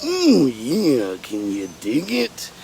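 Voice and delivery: wrestler catchphrase voice, swooping up then dropping very low; Macho Man Randy Savage. Retold or imitated. Recalling the Slim Jim commercials, Macho Man impression. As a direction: growl it like a pro-wrestling pitchman. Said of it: "in the voice of the, uh, Macho Man".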